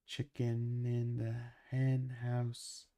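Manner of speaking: slow, drawn-out repeat for chat. Shown as a demonstration. Repeating the line for a confused chat, slowly and deliberately. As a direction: spell it out slowly so chat catches it.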